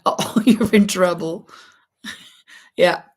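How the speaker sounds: ordinary delivery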